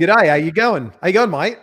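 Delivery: Aussie accent attempt. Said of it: "I like that".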